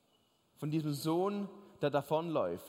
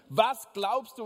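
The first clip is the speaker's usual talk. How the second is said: sharp, louder wife's demand; angry wife; 4 semitones higher, 6 dB louder, slower than usual. Retold or imitated. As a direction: snap at him like an angry wife. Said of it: "jetzt fragt sie wirklich wütend". (wütende Ehefrau, laut und scharf)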